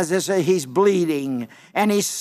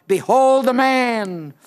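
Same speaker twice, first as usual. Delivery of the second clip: higher, slow proclamation; Pilate crying out; 4 semitones higher, slower than usual. Retold or imitated. Preacher as Pilate presenting the scourged man to the crowd, crying out. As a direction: call out to a crowd, stretching the words. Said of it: "And so Pilate cries out".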